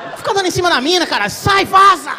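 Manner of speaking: loud exasperated yell at a movie character; mock outrage at the screen. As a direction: shout him away from the girl. Yelling at dumb movie character.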